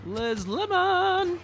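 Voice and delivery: high slow stretched name; drawn-out high call. Pretend.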